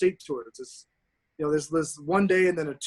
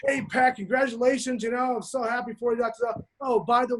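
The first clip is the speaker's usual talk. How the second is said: higher, sugary mock-polite coach; 7 semitones higher than usual. Imitating the college coach's letter, higher and mock-polite. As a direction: fake sweetness, setting up the bill.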